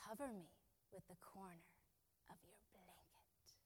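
soft whisper, fading to breath. A narrator's reading. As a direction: whisper it like a secret. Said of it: "she whispered".

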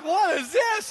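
loud, high exclamation; retold shocked disbelief. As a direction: cry out like a mortified kid. Retold child horrified by mom.

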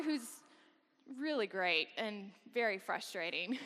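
ordinary delivery

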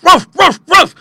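short, sharp dog barks; funny little bark. A narrator's reading. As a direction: yap like a comical little dog. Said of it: "in his funny voice".

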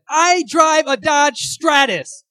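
very slow, high, loud drawn-out shout; triumphant yell. Retold or imitated. Will Ferrell's dad winning the dinner argument, slow hammed-up shout. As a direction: stretch every word as the final blow.

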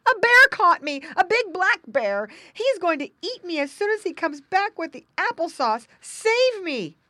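high, loud panicked piglet voice; crying for help. A narrator's reading. Tied pig tells brother of bear, crying out. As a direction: cry out desperately to be saved. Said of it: "cried the pig boy".

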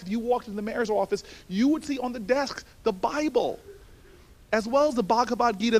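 ordinary talk, nothing marked

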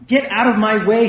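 patient crying out at the doctor, angry. A narrator's reading. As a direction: push him off with the words. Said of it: "she cried".

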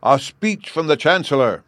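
high, loud call from the crowd; distant shout. A narrator's reading. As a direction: call out a demand over a distance. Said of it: "was understood to shout".

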